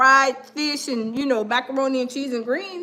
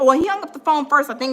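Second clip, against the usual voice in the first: usual speaking voice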